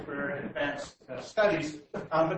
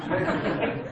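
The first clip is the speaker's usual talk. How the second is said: nervous chuckle; 5 dB louder than usual. Real, not acted. Nervous laughter continues on stage.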